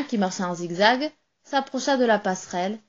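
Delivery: usual speaking voice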